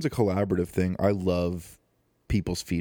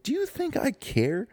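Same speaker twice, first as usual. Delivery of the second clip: defiant. Retold or imitated. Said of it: "I'm like".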